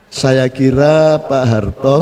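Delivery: low, very slow, hesitant minister voice; imitating Murdiono's halting speech. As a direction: mimic a minister dragging out every word. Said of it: "Jadi Pak Murdiono kalau". (tiruan Murdiono bicara lamban dan rendah)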